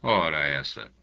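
ordinary delivery, nothing marked

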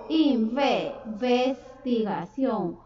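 investigación drawn out very slowly, every syllable spaced; slow dictation. Shown as a demonstration. Teacher spelling investigación for young pupils, very slow. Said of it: "Tilde en la O".

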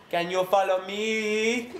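CAN YOU FOLLOW ME stretched out, long final ME; slow, drawling mock-patient question; slow patronising question. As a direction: draw it out as if to someone slow. Patronising check, drawn out.

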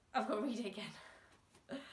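ordinary delivery, nothing marked

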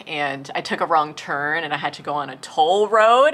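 ordinary talk, nothing marked

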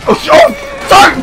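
usual speaking voice

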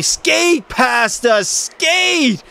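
high, slow stretched yell of skate; cheering his skater on, voice climbing; excited yell. Real, not acted. Streamer urging his skater past, high excited yell.

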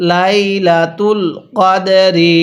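slow drawn-out Quran recitation of লাইলাতুল কদরি; slow tajweed chant. Shown as a demonstration. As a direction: recite লাইলাতুল কদরি slowly, each syllable held. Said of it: "হ্যাঁ পড়ুন".

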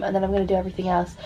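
usual speaking voice

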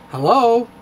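usual speaking voice

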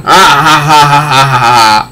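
hammed-up spelled-out villain laugh; mock villain laugh. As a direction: laugh like a cartoon baddie. Villain laugh after taunt.

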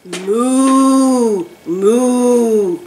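two loud, long imitated moos; cow moo. Teacher giving children a farm sound to guess, cow moo. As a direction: moo like a cow, holding each one. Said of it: "I hear a cow".

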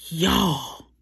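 loud excited call to viewers. Real, not acted. Calling her viewers over her nail art, excited. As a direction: call out to them, thrilled. Design worked first try.

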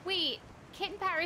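ordinary delivery

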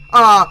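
sudden loud high yell of fright; startled shout. Real, not acted. Gamer jumps when the kitty freaks out, startled yell. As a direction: yell as the scare hits. Cat startles him in horror game.

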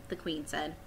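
plain reading voice

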